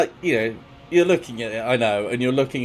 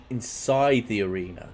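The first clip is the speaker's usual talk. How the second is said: usual speaking voice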